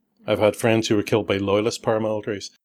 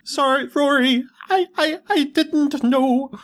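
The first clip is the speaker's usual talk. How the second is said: much higher, slow broken apology; grief-stricken Liam. Pretend. Liam apologizing to his dying brother, high and broken. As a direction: plead through shock and guilt. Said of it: "Liam finds out".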